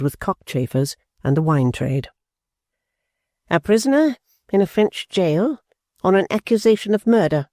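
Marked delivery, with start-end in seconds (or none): 3.5-7.5 higher, measured repeat; steady repetition. A narrator's reading. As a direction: weigh each word as she repeats it. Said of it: "repeated, steadily going over".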